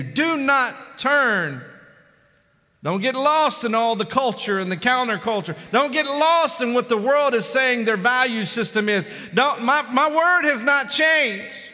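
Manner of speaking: high, driving pulpit exhortation; full-force preaching. Real, not acted. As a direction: press the warning on the congregation.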